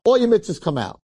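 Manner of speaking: high-pitched summons; calling out. Acted. Heavenly judge summons the angels, high calling out. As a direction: call them out like an announcer.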